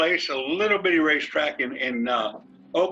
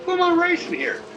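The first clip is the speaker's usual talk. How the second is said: quick, much higher wondering question; puzzled self-question; 14 semitones higher, faster than usual. Retold or imitated. Racer wondering mid-race who he's battling, puzzled. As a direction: ask yourself, puzzled and fast, who's out there.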